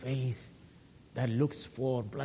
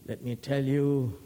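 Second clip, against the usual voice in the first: normal talking voice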